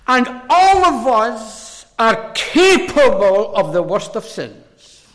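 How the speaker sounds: slow, loud pulpit emphasis; forceful preaching. Real, not acted. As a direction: lean hard and slowly on each word.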